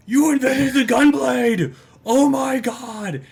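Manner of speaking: high, loud, ecstatic squeal; fangirl shriek. Acted. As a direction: scream it like a starstruck fan. Fangirl outburst voiced by player.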